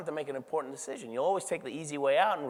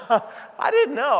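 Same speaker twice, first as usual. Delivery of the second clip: laughing disbelief; 11 semitones higher, 7 dB louder, much slower than usual. Real, not acted.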